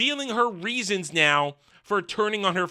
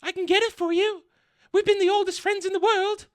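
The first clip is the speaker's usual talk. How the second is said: much higher sweet pleading voice; mock female friend voice; 13 semitones higher than usual. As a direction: coax him, pitched way up. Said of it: "and says".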